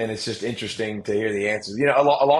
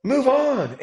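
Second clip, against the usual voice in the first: animated self-talk; 11 semitones higher than usual. Retold or imitated. Retold inner talk, raised pitch.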